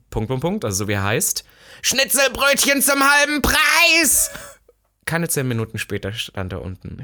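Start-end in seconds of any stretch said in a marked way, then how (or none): 1.8-4.3 slow, high, loud bellow; hammed-up shout of a cheap lunch offer; retold shout. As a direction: yell it like a market crier luring a friend. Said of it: "reingerannt und hab geschrien".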